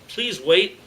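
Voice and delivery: mildly urgent, begging; pleading. A narrator's reading. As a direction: plead with a captor. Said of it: "looked at the leader and pleaded".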